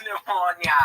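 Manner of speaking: Jamaican-accented Pneumonia, high. Shown as a demonstration. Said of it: "I can kinda say in the Jamaican accent".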